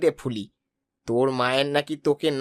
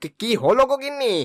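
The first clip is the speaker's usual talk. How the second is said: high-pitched, flustered husband; startled questioning; 7 semitones higher than usual. Acted. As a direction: ask in alarm what the uproar is. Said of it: "এত চেঁচামেচি করছো কেন". (চমকে উঠে চড়া গলায় প্রশ্ন)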